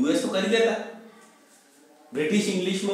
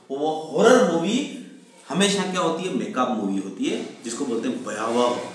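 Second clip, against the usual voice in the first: ordinary talk, nothing marked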